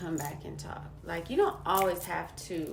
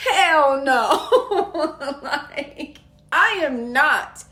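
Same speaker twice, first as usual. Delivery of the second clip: loud, high declaration, drawn out with punchy emphasis; defiant outburst; 8 semitones higher, 13 dB louder, slower than usual. Retold or imitated. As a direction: belt out a fired-up refusal, laughter creeping in. Defiant reaction to realizing her fear.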